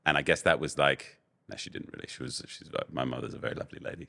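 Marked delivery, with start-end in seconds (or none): 1.5-2.7 quick hushed whisper; whispered aside. Real, not acted. Joking aside about his mother.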